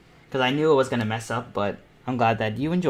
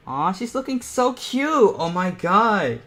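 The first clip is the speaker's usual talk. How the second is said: much higher, louder, slow squeal of delight; gushing over cute; 9 semitones higher, 5 dB louder, slower than usual. Real, not acted. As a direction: coo over the drawing like it's adorable. Gushing over her own drawing.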